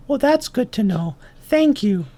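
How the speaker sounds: high-pitched small ant voice; little ant. Acted. Ant thanking the owl, high little voice. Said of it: "And then the ant says".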